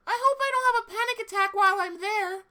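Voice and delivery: put-on squeaky nervous girl voice; high anxious cartoon girl.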